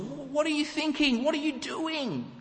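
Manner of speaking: high, pleading mock shout at a foolish king; yelling at Saul. Crowd yelling at their foolish king, high mock shout. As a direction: throw the question up at him in disbelief. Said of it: "We're screaming at Saul".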